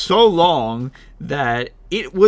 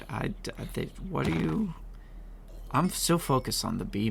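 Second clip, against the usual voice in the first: ordinary delivery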